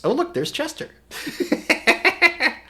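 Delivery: high giggling through the words; laughing. Real, not acted. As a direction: break into a laugh at the photo. Amused at Chester's photo.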